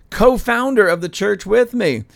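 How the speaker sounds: normal talking voice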